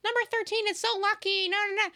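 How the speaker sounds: high, squeaky mock of gushing fans; shrill parody of Swifties. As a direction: ridicule them in a high silly voice. Mocking what people say.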